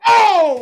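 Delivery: high loud yelp of Ow!. Retold or imitated. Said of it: "I did the [name]".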